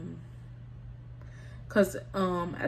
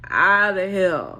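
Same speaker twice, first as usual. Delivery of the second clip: loud sign-off; 8 dB louder, slower than usual. Real, not acted. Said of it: "sign-off".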